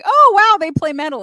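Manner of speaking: high, loud amazed; high loud mock amazement; mock surprise. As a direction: gasp like a new listener. Surprised first-time listener quoted.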